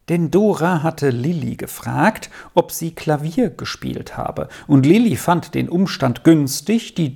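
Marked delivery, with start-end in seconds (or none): none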